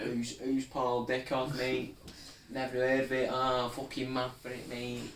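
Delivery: loud, high-pitched Manc lad; chavvy Mancunian. Acted. Fake City fan quizzed on Paul Dickov, chavvy Mancunian. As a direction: play the clueless fan in Manc. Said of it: "chavvy Mancunian accent".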